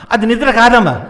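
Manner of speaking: high, loud retort; acted exasperated protest. Son protesting to mother that it isn't sleep, high and exasperated. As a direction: burst out in mock exasperation.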